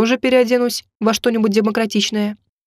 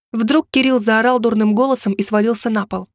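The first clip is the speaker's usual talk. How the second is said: ordinary delivery, nothing marked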